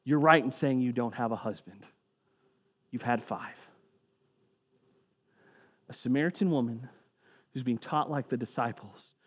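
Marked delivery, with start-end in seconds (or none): 0.0-3.6 soft, lower knowing callout; quiet callout. Retold or imitated. Jesus quietly naming the woman's past, soft. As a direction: gently call her out, hushed. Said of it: "him going".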